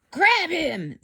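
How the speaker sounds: rally the others; a touch of a shouting mob; mild mock shout. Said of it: "they shouted".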